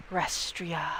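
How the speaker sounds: soft, breathy ghost whisper; ghostly whisper. Acted. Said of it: "whisper out".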